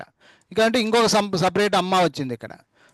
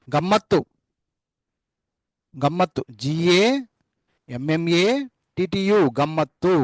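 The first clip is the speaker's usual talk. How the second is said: slow spelling out; slower than usual. Shown as a demonstration. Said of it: "కొంచెం ఒత్తి పలుకుతాం".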